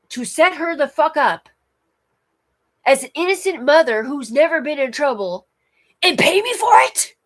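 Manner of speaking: high, loud, furious rant building to a shriek; imitating a woman cussing out her boss; retold angry yelling. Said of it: "is literally yelling at her boss".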